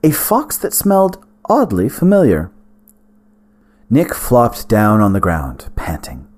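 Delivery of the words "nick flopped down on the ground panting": usual speaking voice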